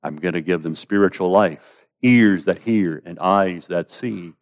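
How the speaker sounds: hushed, patient promise; calm reassurance. Acted. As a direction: answer the protest quietly and surely. Acts out God's calm reply.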